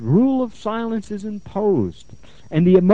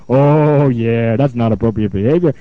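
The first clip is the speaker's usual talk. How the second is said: mock agreement; 7 semitones lower, 5 dB louder than usual. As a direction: play up agreeing with her, low-pitched. Therapist voicing her reply to client.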